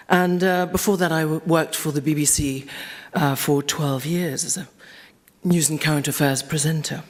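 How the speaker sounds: ordinary delivery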